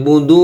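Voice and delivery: "বুদু" with the last vowel held very long; three-alif stretch. Shown as a demonstration. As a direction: chant out the long ending of "বুদু". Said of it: "শেষে কিন্তু তিলের প্রাণ হবে". ("বুদু" শেষে তিন আলিফ টেনে)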